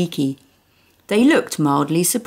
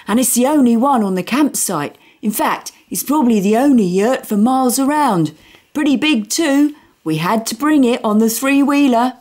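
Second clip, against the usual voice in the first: higher, eager boasting; proud boy's voice; 7 semitones higher, 4 dB louder than usual. Acted. Boy boasting to the family about the yurt, proud. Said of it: "Lancelot announced proudly".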